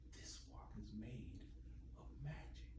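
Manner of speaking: soft voiceless whisper; whispered thought. Acted. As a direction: hush it like a secret thought. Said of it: "He thought".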